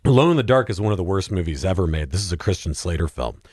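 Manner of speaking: ordinary talk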